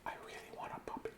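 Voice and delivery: hushed, hurried whisper. Acted. Sneaking in a wish for a puppy, whispered. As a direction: whisper it very fast under the breath.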